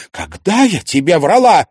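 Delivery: loud, high, challenging question; indignant retort. A narrator's reading. Mother challenging her son's accusation, indignant. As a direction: flare up at the accusation. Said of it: "вскинулась мать".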